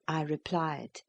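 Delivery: ordinary delivery, nothing marked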